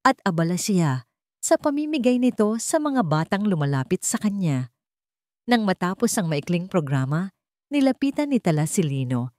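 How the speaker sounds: usual speaking voice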